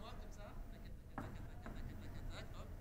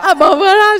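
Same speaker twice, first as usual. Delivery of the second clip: excited shout; 6 semitones higher, 11 dB louder than usual. Real, not acted. Reacting to the magic cloud call.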